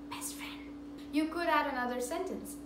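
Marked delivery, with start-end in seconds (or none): 0.1-0.6 conspiratorial whisper; whispered aside. Real, not acted. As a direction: whisper best friend, no voice. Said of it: "whispered aside".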